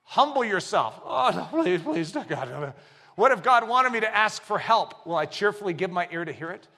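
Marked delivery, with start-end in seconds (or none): 1.0-2.7 fading, laughing begging no; mock pleading. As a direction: beg God with a mock whine. Acting pleading with God.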